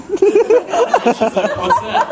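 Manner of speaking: high, slow laugh through the words. Real, not acted. Comedian laughing while retelling the kiss, high and slow. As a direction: laugh out the lead-in.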